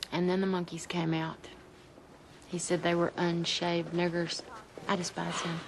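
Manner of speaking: low, subdued monotone; soft, low and drained; quiet, bitter recollection. Acted. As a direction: recall it softly with buried resentment. Bitter memory in hospital waiting room.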